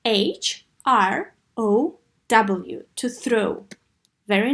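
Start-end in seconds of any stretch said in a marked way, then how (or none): 0.1-2.9 slow, letter by letter spelling. Shown as a demonstration. Teacher spelling a tricky word for the class, slow and clear. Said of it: "Let's spell it because it's tricky".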